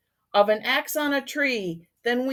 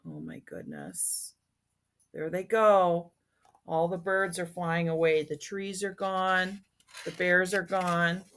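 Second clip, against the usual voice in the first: hushed aside; 3 semitones lower, 6 dB quieter than usual. Real, not acted. Storyteller's hushed aside to listeners.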